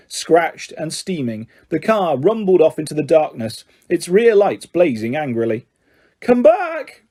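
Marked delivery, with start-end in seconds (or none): 6.2-7.0 high yell after it. A narrator's reading. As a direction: call out desperately. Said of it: "Ron yelled after it".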